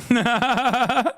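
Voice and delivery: rapid string of high ha-has; high mocking laugh. Real, not acted. Streamer laughing at complaining viewers, high cackle.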